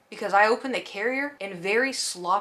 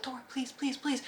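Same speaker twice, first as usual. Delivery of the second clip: much softer, higher begging; pleading softly; 5 semitones higher, 10 dB quieter than usual. Retold or imitated. Owner begging her post-surgery cat to stop climbing, soft pleading. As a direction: plead under your breath, higher.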